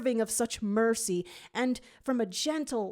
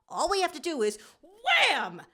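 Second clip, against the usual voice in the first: much higher and louder, jolted; startled cut-off; 10 semitones higher, 4 dB louder than usual. A narrator's reading. Tails gets shot at mid-sentence, startled high cry. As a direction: break off with a yelp. Sudden attack interrupts.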